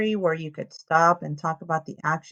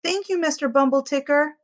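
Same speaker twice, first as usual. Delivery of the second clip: calling out; 8 semitones higher, 4 dB louder than usual. Acted. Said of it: "calls Gus".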